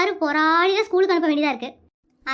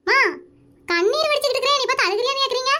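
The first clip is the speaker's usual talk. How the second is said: higher-pitched protest to mother; aggrieved complaint; 4 semitones higher than usual. Acted. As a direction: complain aloud, pitched up, hurt at being doubted. Boy complains aloud to mother. (அம்மாவிடம் உயர்ந்த குரலில் புலம்பல்)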